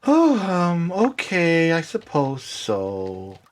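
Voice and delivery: slow, drawn-out grudging swan; reluctant agreement. Acted. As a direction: drag it out, trailing down. Game master voices reluctant Featherine.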